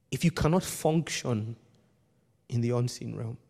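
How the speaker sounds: low, soft and grave; solemn quiet. Real, not acted. Preacher warning Tony about spiritual leaders, hushed and grave. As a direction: drop right down and speak gravely.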